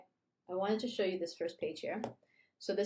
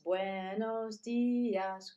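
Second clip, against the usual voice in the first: teacher modeling slowly; slow model greeting; much slower than usual. Shown as a demonstration. Teacher modeling slow greeting for young learners. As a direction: stretch each syllable slowly for learners. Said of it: "Let's try it again".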